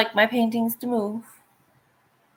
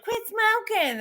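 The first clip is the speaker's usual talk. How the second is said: mock nagging friend; 13 semitones higher than usual. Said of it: "she's like".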